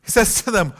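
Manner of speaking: ordinary delivery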